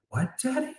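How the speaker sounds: high, childlike "what, daddy?" in mock surprise; kids asking. Kids in the car asking their dad, in a high childlike voice. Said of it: "they're like".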